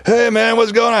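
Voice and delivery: high, easygoing dude voice; mimicking a casual greeting. Imitating the friend at the door, high and innocent. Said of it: "He's like".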